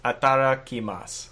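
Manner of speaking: unwitting Italian accent on "Atarakimasu"; a touch of Italian lilt; stumbling into an Italian accent. Real, not acted. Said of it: "saying it with an Italian accent".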